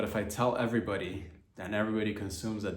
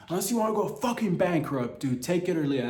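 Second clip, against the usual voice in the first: mock hard bargainer; 5 semitones higher than usual. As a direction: slam down a lowball offer. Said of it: "be like".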